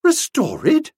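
high, loud, incredulous echo; crying out. A narrator's reading. Young woman cannot believe her ears, crying out. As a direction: cry out in disbelief. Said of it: "cried Nobby".

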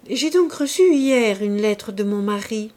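plain reading voice

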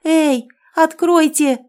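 high, loud, drawn-out hysterical cry; trapped woman's panicked yell; hysterical shouting. A narrator's reading. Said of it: "Голос её звучал истерично".

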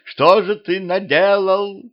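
high, slow lament; drawn-out reproachful wail. A narrator's reading. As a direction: wail it out, stretching the words. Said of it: "заголосила Мария".

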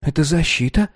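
lightly voiced little boy; earnest small boy explaining; child voice. A narrator's reading. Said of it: "пояснил мальчик".

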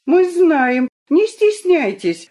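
high, loud, happy group cheer; joyful shout. A narrator's reading. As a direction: call out gladly together. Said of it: "радостно закричал весь игровой состав".

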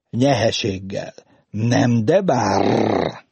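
"Nyeheséggel" with its R softened to an H; Pinta's R defect, heard in "Nyeheséggel" and "bár"; burred R. A narrator's reading. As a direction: end on a long burred R in "bár". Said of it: "Most nem racsolt".

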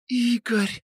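high soft breathy «Игорь!» with a falling end; breathy exhale. A narrator's reading. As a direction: sigh out «Игорь!» in bliss. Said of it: "выдохнула она тихо".

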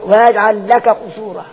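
slow recited ويجعل لك قصورا with the كاف merged into the قاف; tajweed merging demo; merged kaf into qaf. Shown as a demonstration. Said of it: "هذا برضه الكاف في القاف".

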